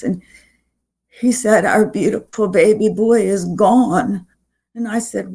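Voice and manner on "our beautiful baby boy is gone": ordinary delivery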